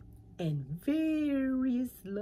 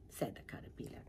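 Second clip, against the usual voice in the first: ordinary delivery, nothing marked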